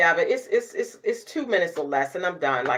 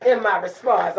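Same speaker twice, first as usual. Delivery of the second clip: usual speaking voice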